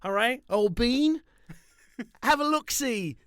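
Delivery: put-on high, jaunty "old bean" British voice; mock British accent. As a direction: play a cartoon English gent. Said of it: "talk like that the rest of the episode".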